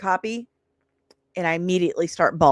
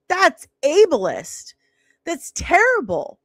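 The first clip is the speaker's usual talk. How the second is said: retold angry outburst; 6 semitones higher than usual. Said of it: "lost my mind".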